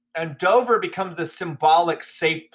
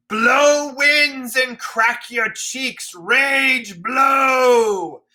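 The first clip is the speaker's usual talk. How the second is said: very slow, much higher and louder roar; raging shout at the storm; 7 semitones higher, 4 dB louder, much slower than usual. Acted. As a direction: bellow it into the wind like a madman. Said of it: "he's screaming at the heavens".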